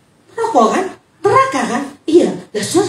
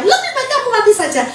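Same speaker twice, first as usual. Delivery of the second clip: parrot echo; 12 semitones higher, faster than usual. Acted. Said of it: "Mertua bilang sama menantunya".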